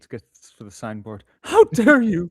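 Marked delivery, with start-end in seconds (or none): 1.4-2.3 loud, hammed-up how dare you; mock indignation. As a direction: play the offended diva. Mock outrage at the streamer.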